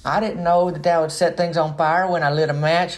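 put-on high kid voice, rising, protesting; innocent kid. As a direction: whine like a kid caught out. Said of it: "they're like".

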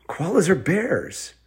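put-on high, slow kid voice; child realizing. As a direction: offer it tentatively in a high little voice. Ellie offers an idea.

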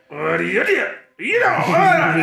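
anime villain voice. Retold or imitated. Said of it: "una sola voz de villano que es como".

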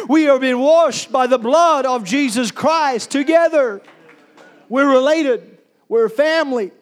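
rising pulpit preaching; full-force preaching. Acted. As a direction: preach it up to a climax. Preaching about the blood of Jesus.

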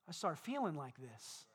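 low, soft, slumped voice; downcast demo. Shown as a demonstration. Preacher showing how slumped posture sounds, downcast. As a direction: let the words sag as if weighed down. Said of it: "If I walk around like this".

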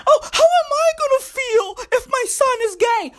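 high-pitched wail of a fretting parent; mock distressed parent. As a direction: whine it way up high, hammed-up. Mocks a self-centred worried parent.

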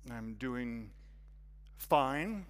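low, held-back answer, "fine" lifting at the end; guarded "fine". Acted. Newcomer hides his troubles from the hostess, guarded. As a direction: insist you're fine, keeping it contained.

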